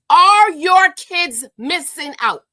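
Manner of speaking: loud, slow accusing question; furious drawn-out challenge; angry demand. Real, not acted. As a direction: jab each word at her. Confronting absent wife.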